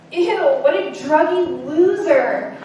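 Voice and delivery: slow, loud, laughing sneer; mock her past self's judgmental disgust; disgusted scorn. Recalling her first reaction to her friend's news, scornful and laughing. And my response was.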